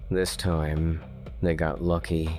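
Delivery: usual speaking voice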